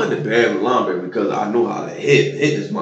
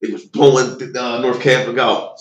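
ordinary delivery

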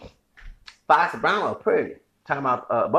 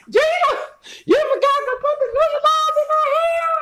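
much higher, louder, laughing through a put-on shrill voice; shrill mock sitcom voice; 4 dB louder than usual. Mocking a sitcom character yelling at Gina, high and laughing. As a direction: squeal it like a sitcom character, cracking up.

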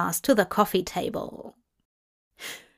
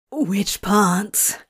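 breathless. Acted. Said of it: "Crowley asked breathlessly".

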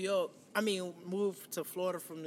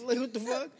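ordinary talk

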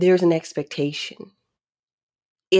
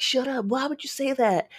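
mock irritation; 9 semitones higher than usual. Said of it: "So I'm not irritated, like".

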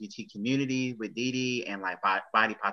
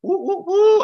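excited whooping; 13 dB louder, slower than usual. Real, not acted. Host hyping final episode.

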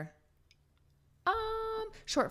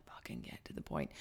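whisper; 15 dB quieter than usual. Retold or imitated. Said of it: "I'm like".

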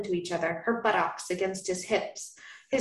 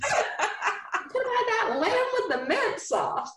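much higher, slow, laughing Southern drawl; laughing mock Southern man; Southern accent; 13 semitones higher, slower than usual. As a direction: ham up a Southern gent through giggles. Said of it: "I don't know why I'm making him Southern again".

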